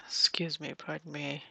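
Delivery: soft unvoiced excuse me; whispered excuse me. Real, not acted. Whispering excuse me to a villager in the way. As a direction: whisper it politely past them. Squeezing past villagers in game.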